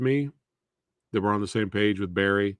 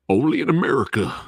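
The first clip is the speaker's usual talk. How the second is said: Don King impression; 3 semitones higher, 5 dB louder, faster than usual. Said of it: "Why did I have an accent".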